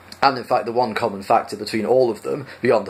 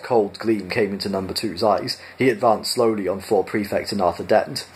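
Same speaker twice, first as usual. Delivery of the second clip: usual speaking voice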